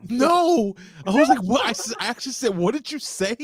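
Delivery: high-pitched incredulous retelling; retold disbelief. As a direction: act out being stunned, high voice. Retells shocked reaction.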